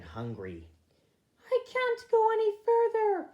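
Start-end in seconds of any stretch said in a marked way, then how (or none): 1.5-3.3 very high, loud whiny wail; wailing little rabbit. A narrator's reading. Smallest rabbit wailing to the group, very high and whiny. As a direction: wail it like the tiniest, weariest bunny. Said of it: "wailed Pipkin".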